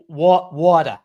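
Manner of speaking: put-on loud, halting stab at a Boston accent; hammed-up Boston "water". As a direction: try WATER Boston-style, dropping the R. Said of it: "When in Boston, we say".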